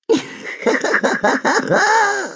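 very high, eerie giggle; strange giggle. Acted. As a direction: giggle like an odd little devil. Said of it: "kichert das Wesen erneut höchst befremdlich".

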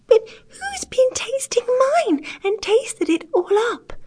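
very high, tearful tiny bear; teeny tiny bear voice. A narrator's reading. Poor little bear finds soup eaten, tearful tiny squeak. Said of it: "in a teeny tiny voice".

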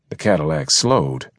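plain reading voice

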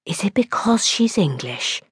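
scandalised stage whisper. A narrator's reading. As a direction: whisper it like secret gossip. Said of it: "had asked in a whisper".

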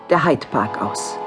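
usual speaking voice

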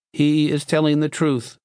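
high, monotone machine voice; toneless metal box. A narrator's reading. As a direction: intone it like a talking machine. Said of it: "the box affirmed".

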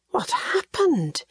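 high and slow, stunned by the sight; a wife stopping in horror at the ruined house; horrified. A narrator's reading. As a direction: breathe it out in shock. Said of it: "Catherine stopped in horror".

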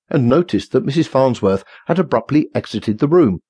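ordinary delivery, nothing marked

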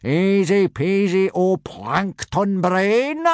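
high, drawn-out hearty boom; booming, self-satisfied. A narrator's reading. Gobber answering a pupil's doubt, smug drawn-out boom. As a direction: boom it out slowly, very pleased with yourself. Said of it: "boomed Gobber, very pleased with himself".

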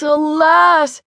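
the despairing echo, pushed up; exclaiming alas. A narrator's reading. Simpleton exclaims to his comrades in despair. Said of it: "he exclaimed".

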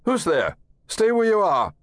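high, loud alarmed shout. Acted. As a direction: yell out at the intruder. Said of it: "as the coach shouted".

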